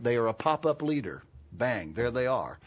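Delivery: normal talking voice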